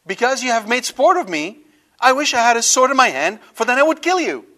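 loud, high-pitched angry outburst; mad Balaam. Acted. Balaam threatens the donkey that made sport of him, angry. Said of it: "He's so mad that the donkey made fun".

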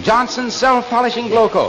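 lightly voiced radio pitchman naming the sponsor; radio announcer voice. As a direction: announce the product like a radio commercial. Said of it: "I can hear the voice saying".

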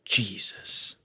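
breathy unvoiced "Jesus" with a falling tune; exasperated whisper of "Jesus"; whispered groan. Real, not acted. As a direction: let it out as a weary whisper. Disgusted at the game.

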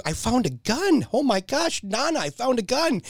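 put-on high, excited kid voice; a small boy thrilled at his find; excited little boy. Young nephew showing his Nana a gun, high and excited. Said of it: "He's like".

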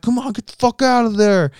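high, loud impatient yell; mock yell. Big dude yelling at the bathroom door, mock holler.

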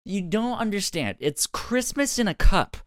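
put-on high, loud drunk insistence. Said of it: "she goes".

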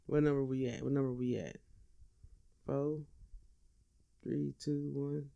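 softly muttering the count under his breath; quietly checking his place, almost to himself; muttered to himself. Real, not acted. Comic checking his list, muttering the countdown to himself.

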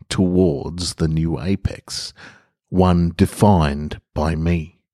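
calm, measured reading of a personal essay; story narration. Acted. Reads his reflective story.

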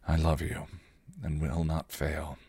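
soft, low, slowing at the end; hushed, heartfelt promise; tender confession. Acted. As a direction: confess it quietly and sincerely. Bittersweet recital turns into a confession.

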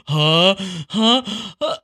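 sharp breathy sneeze build-up; pre-sneeze gasps. Retold or imitated. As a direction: gasp like a sneeze is coming. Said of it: "once you actually do sneeze".

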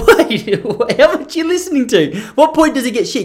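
high, incredulous questioning; mock alarm. Podcaster retells questioning his critic, high-pitched mock alarm. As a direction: challenge him in a high, comically alarmed voice.

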